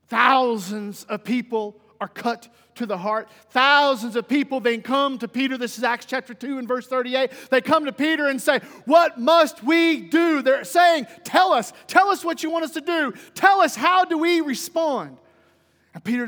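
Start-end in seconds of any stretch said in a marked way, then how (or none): 0.1-8.6 loud, driving pulpit storytelling; forceful preaching. Real, not acted. Preacher recounting crowds coming to Peter, forceful. 8.8-15.2 loud, high cry with stretched words; urgent plea. Acted. Crowd begging Peter to tell them what to do, urgent.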